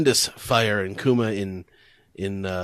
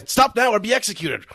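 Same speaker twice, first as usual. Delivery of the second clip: a lightly raised, higher command; gunman threat; 9 semitones higher, 4 dB louder than usual. Retold or imitated. Gunmen warning Kuma, lightly raised command. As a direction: threaten the stranger. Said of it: "they say".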